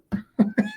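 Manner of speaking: short chuckle at own remark; laugh. Real, not acted. Laughs after the wife remark.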